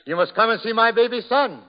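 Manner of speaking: hammed-up crowing dad; bragging new father. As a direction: announce it proudly to everyone passing by. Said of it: "telling all the people".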